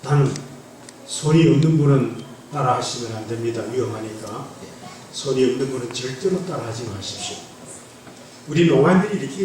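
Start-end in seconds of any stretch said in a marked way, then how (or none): none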